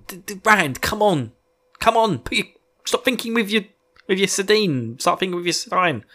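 high, pleading come on; urging a distracted hero to think; exasperated scolding. Real, not acted. Podcaster scolding Rand for thinking with his sardine, high exasperated.

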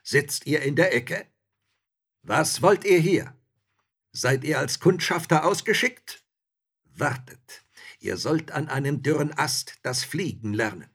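loud, high interrogation; menacing robbers. A narrator's reading. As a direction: robbers barking questions at intruders. Robbers threaten intruders.

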